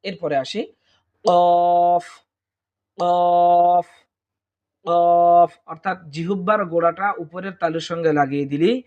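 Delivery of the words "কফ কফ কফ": কফ from the back of the tongue, louder; deep Arabic qaf. Shown as a demonstration. Teacher modelling কফ for the class, from the throat. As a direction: punch কফ from the throat. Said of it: "আমরা কফ হরফটি পেয়ে যাব".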